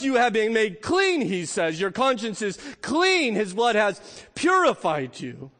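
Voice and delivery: ordinary delivery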